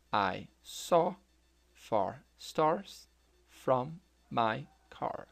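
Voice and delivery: "I saw far stars from my car" drawn out word by word; very slow model sentence. Shown as a demonstration. Teacher slowing down so students repeat, very slow. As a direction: stretch it so learners can copy. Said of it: "Tente repetir essa frase também".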